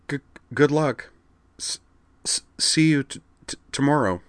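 high, halting stammer; stuttering shy girl. Acted. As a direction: stumble nervously over each word. Kate voiced with a stutter.

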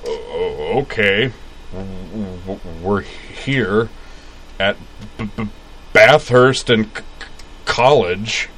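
slow, stammering voice of Moose; stuttering impression. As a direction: stutter through it slowly. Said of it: "Caitlin's impression of Moose".

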